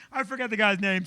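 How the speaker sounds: usual speaking voice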